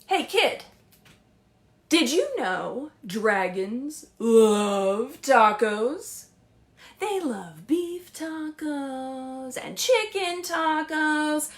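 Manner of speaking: slow, playful read-aloud; lively picture book narration; story narration. Acted. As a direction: draw the kids into the story. Reading Dragons Love Tacos aloud.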